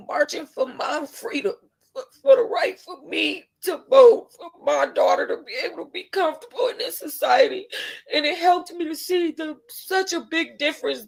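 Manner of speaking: high voice breaking with real tears; tearfully emotional. Real, not acted. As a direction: cry through it, pitch climbing. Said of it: "I'm really emotional about this".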